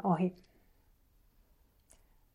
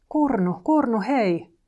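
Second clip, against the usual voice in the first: raised, higher call with falling tune; 5 semitones higher, 6 dB louder, slower than usual. A narrator's reading. Loikka calls sleeping Kurnu awake, calling out. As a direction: call a name to rouse someone.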